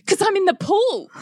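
high-pitched mock indignation; exasperated retort. Acted retort in the quarrel.

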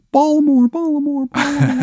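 put-on high Baltimore accent, fading like an echo; high-pitched voice echoing away in a Baltimore accent. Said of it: "his terrible accent, like, echoing".

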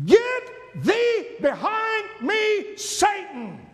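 high, loud and slow command; thundered rebuke. Acted. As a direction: thunder it down at him. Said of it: "And he thundered that word".

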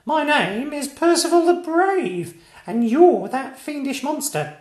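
put-on a small boy boasting bravely; piping kid knight proclaiming; little knight. A narrator's reading. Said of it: "said Tiny".

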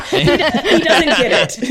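slow words through laughter; laughing. Real, not acted. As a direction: laugh while saying it. Laughing aside.

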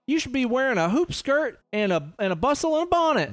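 prissy mock rebuke; mock scolding. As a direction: scold her like a shocked townsman. Mocking 1830s scolding.

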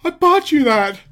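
high, loud panicked yell; panicked shriek. Retold or imitated. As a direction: shriek it like a frantic manager. Heyman panicking at Brock.

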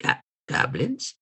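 a terrified little whimper of a question; frightened stammer. Acted. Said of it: "looks at you and goes".

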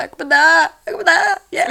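high, hammed-up panic; mock panic. Lying on the clinic cot, hammed-up panic about dying. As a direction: ham up being about to die.

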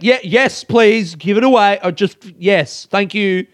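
curt, irritated politeness; annoyed snapping. Retold or imitated. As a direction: snap it out in exasperation. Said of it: "kinda snapped at him 'cause I was annoyed".

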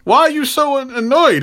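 high mock exasperation; Luke Cage. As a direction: interrupt in a high, questioning imitation. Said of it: "Luke's getting involved going".

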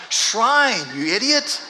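playful mock jab from wife; teasing wife. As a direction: needle him like a wife. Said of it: "my wife".

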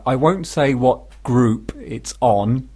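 normal talking voice

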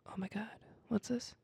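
hushed, tense whisper. Acted. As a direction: breathe it like something's coming. Tense story moment, drums arrive.

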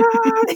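a high, comic yelp of alarm; high mock panic shriek. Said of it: "it's like".

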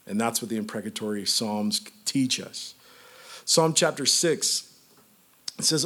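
ordinary talk, nothing marked

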